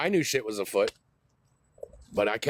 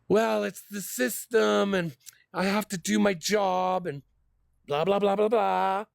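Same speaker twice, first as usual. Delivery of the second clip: mocking officer excuse; 8 semitones higher, slower than usual.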